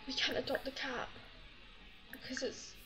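urgent little whisper. Real, not acted. Fretting to herself about the cat, whispered. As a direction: whisper it so nobody hears.

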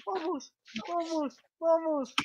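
high, slow chant of vamos; eager rising cheer; excited cheering. Real, not acted. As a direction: urge it on, pitch up. Cheering on a Pokémon.

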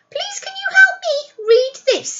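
high, loud pleading wolf voice; wolf asking the neighbour for help, high and pleading. A narrator's reading. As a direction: beg sweetly in a high wolf voice. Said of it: "he asked".

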